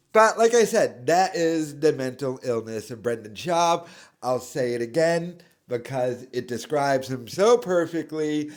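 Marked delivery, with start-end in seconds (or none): none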